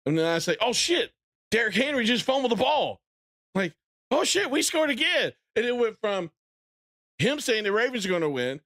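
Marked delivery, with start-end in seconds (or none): 0.6-3.0 high-pitched, re-enacted astonishment; retold shocked excitement. Fan recalls the Derrick Henry fumble, excited surprise. 4.1-5.3 very high-pitched excited outburst; retold elated disbelief. Fan re-enacts the team scoring again, elated surprise. Re-enacting joy at another score.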